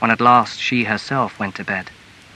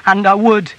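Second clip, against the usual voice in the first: high, loud, anguished outcry; distraught wife protesting; tearful cry; 9 semitones higher, 6 dB louder than usual. A narrator's reading. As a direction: cry it out in powerless despair. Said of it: "she cried in tears".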